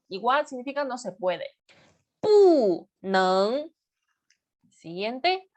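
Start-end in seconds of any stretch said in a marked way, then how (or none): 2.2-3.7 Bu falling sharply, neng rising; fourth tone kept. Shown as a demonstration. Teacher showing Mandarin tones, Bu falls and neng rises. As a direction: drop hard on "Bu", then lift "neng". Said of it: "mantenemos el cuarto tono".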